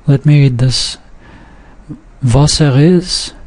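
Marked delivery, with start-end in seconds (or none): 2.2-3.3 "Was er is" slow, merged without breaks; no glottal stop before er or is in "Was er is"; words run together. Shown as a demonstration. Teacher reading an example for learners, words run together. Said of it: "there are no breaks between them".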